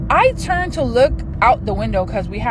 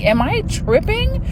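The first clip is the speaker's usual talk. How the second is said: high-pitched baffled question; retold bewildered self-doubt; 9 semitones higher than usual. Questioning herself as nobody else panics, retold bewilderment. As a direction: act out doubting your own ears.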